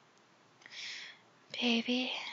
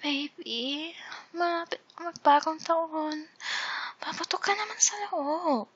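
seductive voice; 7 semitones higher than usual. Shown as a demonstration. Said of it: "very different seductive modes".

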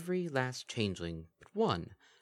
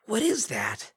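ordinary delivery

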